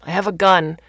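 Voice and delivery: loud warning from another room; loud threat. Acted. Father in the bedroom warning off whoever came in, loud threat. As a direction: threaten the intruder loudly.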